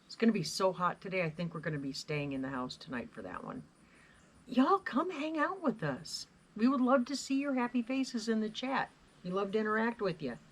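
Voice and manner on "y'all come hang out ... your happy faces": excited invite. Real, not acted. Calling viewers to the live show.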